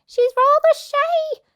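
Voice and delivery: very high, loud sly voice; coy goose. Acted. Goose fooling the thieves, very high. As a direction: tease them coyly. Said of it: "the goose replied".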